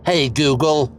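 a firm, measured command; said like someone in charge; with authority. Shown as a demonstration. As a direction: address the assistant with authority. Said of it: "say it with authority".

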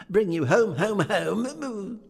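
put-on high, bright child's calling out; little sister calling her brother home, high and eager; excited little girl. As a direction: squeal it like a delighted little girl. Child voice in story.

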